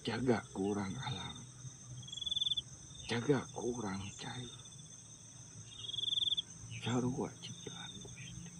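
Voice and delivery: put-on slow, solemn old man spirit; possessed elder voice. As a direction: speak slowly as an entranced elder. Speaks as a channelled elder spirit.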